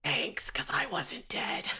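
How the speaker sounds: slow, hushed whisper back; soft, slow, voiceless answer; whispered reply. Acted.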